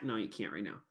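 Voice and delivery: low-key, very soft aside to the puppet; hushed correction. Acted. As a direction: rein the bear in quietly. Teacher quietly refusing the puppet.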